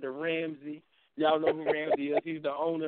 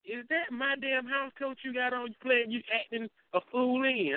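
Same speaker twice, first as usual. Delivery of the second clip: high-pitched mock scolding, run straight through; acted-out fussing, pitched up; retold scolding mama; 9 semitones higher than usual. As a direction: retell an indignant telling-off in a higher voice. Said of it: "she hit me one day and said".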